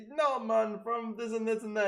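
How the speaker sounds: high, lightly voiced viewer answering back; mock viewer reply. Viewer replying to the streamer, light high mock voice.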